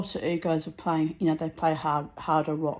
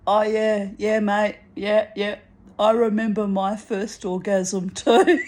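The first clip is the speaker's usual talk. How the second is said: deep voice; 6 dB louder than usual. Retold or imitated. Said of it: "in a really deep voice".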